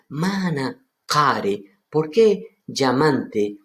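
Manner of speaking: slow, deliberate "Mana care"; slow reading. Shown as a demonstration. Teacher slowly reading the Aramaic to the class. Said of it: "Entonces dice".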